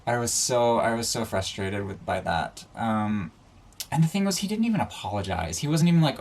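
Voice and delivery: ordinary talk, nothing marked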